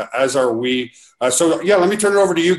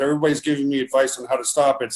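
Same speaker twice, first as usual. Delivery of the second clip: ordinary talk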